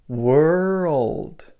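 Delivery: put-on "world" said as one linked "were-old"; "world" with the linking sound. Shown as a demonstration. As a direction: blend "were" into "old" smoothly. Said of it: "Can you hear that?".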